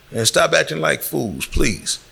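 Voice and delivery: ordinary talk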